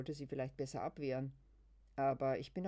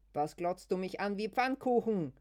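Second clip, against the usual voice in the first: loud, high furious yell; shout; 6 semitones higher, 10 dB louder than usual. Acted. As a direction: scream it so strangers turn. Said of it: "schreit Luise so laut". (lauter, hoher Schrei)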